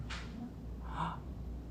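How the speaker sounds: sudden startled gasp; sharp gasp. Real, not acted. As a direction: gasp in surprise. Sudden alarm at plumber.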